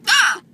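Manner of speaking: high honking hiccup; dying goose hiccup. Real, not acted. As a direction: honk like a goose. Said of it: "sound like a dying goose".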